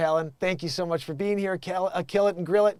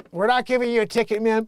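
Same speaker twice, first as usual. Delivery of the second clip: louder, higher, hurried cop voice; acted cop; 6 semitones higher, 5 dB louder than usual. Cop telling crying driver there's no ticket, acted firm officer. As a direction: project it quickly like an officer over sobbing.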